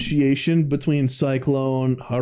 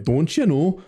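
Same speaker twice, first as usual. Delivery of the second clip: higher, rounded Minnesotan 'o'; folksy mock-Minnesotan lilt; Minnesota accent; 4 semitones higher than usual. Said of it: "a fucking Minnesota fucking".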